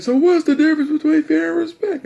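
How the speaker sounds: very high, loud imitation of Dad; demanding yelling dad. Dad testing son on fear and respect, demanding. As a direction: yell the question as an exasperated father.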